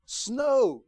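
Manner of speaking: high, loud thrilled cry; excited child. Retold or imitated. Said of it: "It's like".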